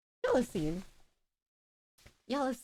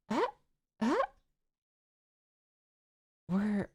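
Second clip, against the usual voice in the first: usual speaking voice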